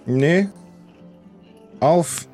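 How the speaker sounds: normal talking voice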